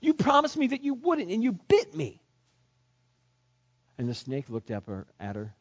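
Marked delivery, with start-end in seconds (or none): 0.0-2.2 much higher and louder, indignant; hurt, angry accusation; betrayed girl crying out. A narrator's reading. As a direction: cry out in shock at the betrayal. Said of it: "threw it on the ground and said".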